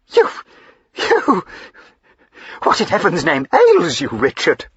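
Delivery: shocked wife gasping at her husband's rage; stunned. Acted. Wife stunned by her husband's outburst, stammering disbelief.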